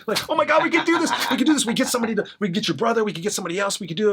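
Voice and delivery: very high, loud giddy squeal with a laugh; mock girlish excitement, breathless with joy; giddy schoolgirls. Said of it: "like giddy little schoolgirls".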